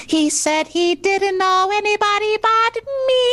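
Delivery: put-on high-pitched pleading kid; child voice. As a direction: plead like a little boy. Child character Timmy pleading.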